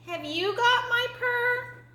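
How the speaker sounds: very high, slow kitten voice; high, drawn-out kitten question. Acted. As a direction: ask hopefully as a tired little kitten.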